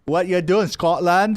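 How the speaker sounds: loud, high Scottish brogue; Scottish accent. Shown as a demonstration. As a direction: ask it like a Scotsman. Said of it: "can you do a Scottish accent?".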